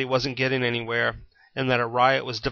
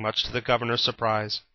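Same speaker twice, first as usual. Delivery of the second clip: ordinary delivery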